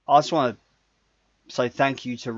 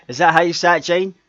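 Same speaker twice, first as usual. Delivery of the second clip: ordinary delivery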